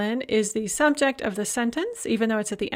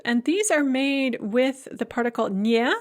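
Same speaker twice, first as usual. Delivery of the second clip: normal talking voice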